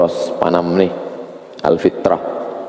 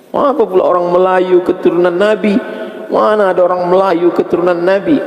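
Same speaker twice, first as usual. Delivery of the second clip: preaching at full force; 13 semitones higher than usual. Acted. Preacher mocking the claim to the crowd, scornful full-force preaching. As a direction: hurl the question at the congregation. Rhetorical challenge in sermon.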